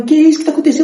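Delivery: voz aguda e alarmada de mãe; mãe assustada. Retold or imitated. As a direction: perguntar em susto o que houve. Mãe assustada com o filho. (mãe assustada vendo o filho passar mal)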